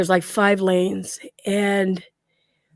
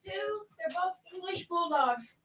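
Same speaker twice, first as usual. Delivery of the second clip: distant, much softer voice, pitched up; away from mic; 8 semitones higher, 17 dB quieter than usual. Real, not acted. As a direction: call it faintly from across the room. Stepped away to let dog out.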